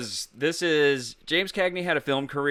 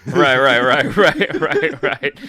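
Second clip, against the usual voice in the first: laughing; 3 semitones higher, 10 dB louder, slower than usual. Real, not acted. Laughing at a mouthful-of-food joke.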